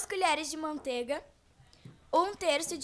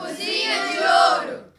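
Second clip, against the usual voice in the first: shouted, higher, louder segment name; jingle shout; 6 semitones higher, 10 dB louder than usual. Acted. Radio host to listeners, shouting the segment name. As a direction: belt out the show's slogan.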